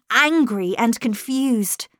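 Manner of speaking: plain reading voice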